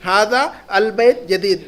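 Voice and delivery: ھذا البیت جدید said slowly, with a long ھذا; the teacher drills the new phrase for the class; slow Arabic model reading. Shown as a demonstration. Teacher drilling the Arabic phrase for students, slow and clear. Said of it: "ھذا کو add کر دیں گے".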